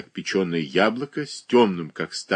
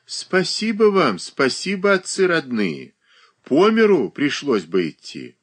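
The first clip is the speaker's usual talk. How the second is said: a lightly voiced old woman; mumbling old woman; 7 semitones higher than usual. A narrator's reading. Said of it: "шамкая и охая".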